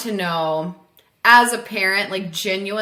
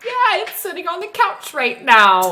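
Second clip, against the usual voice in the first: high, put-on kid voice; pretending child; 10 semitones higher, 4 dB louder than usual. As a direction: play a child insisting it's true. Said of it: "I would try to be like".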